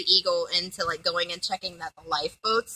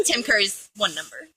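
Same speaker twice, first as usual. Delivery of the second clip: usual speaking voice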